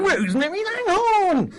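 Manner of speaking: loud, high, drawn-out fanfare introduction; big guest announcement. Real, not acted. Host unveiling the legendary guest, big announcement. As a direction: belt out the name, stretching the end.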